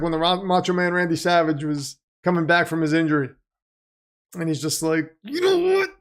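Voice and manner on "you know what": very high, strained gravelly wrestler growl. Retold or imitated. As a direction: snarl it like a hyped-up wrestling promo. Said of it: "I can't do a [name]".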